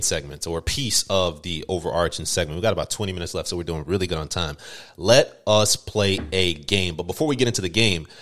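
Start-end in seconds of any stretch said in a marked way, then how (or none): 5.0-7.0 slow dramatic announcement. Acted. Announcing the game segment.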